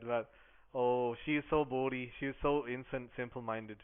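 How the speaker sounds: usual speaking voice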